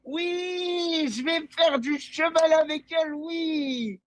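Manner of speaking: high, giddy yes yes yes; overjoyed. Real, not acted. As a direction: squeal with joy at the news. Kid thrilled girl said yes.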